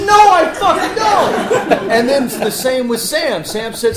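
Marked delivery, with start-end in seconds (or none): 0.0-1.9 much higher, louder, slow, laughing protest; mock panic. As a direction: wail it out in laughing mock despair. Panicked reply to Kurt.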